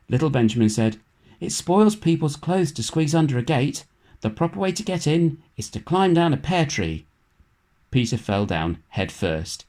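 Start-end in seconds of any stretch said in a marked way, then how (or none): 1.4-7.1 Benjamin's voice. A narrator's reading. Benjamin speaks in character.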